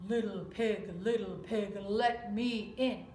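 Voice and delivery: big bad wolf. Wolf at the straw house door, slow and menacing. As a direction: lean on each word like a predator.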